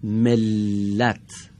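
ملت stretched very slowly, the doubled ل held; slow demo word. Shown as a demonstration. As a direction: read ملت syllable by syllable for the class. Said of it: "کدام حرفش دارای تشدیده".

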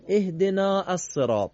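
اهدنا الصراط read ehdena-s-serat, alef dropped; recited phrase. Shown as a demonstration.

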